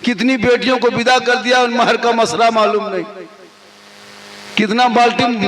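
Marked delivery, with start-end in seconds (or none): none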